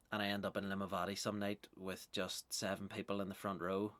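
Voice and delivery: ordinary talk, nothing marked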